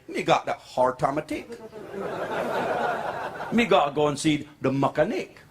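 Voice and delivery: put-on old-timer's West Indian accent. Old man mispronouncing car words, West Indian accent. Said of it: "still got the West Indian accent".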